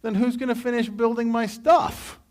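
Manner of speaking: high, whiny mock Pharaoh; high-pitched petulant king; indignant Pharaoh. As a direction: play a spoiled king whose builders walked off. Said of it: "he went".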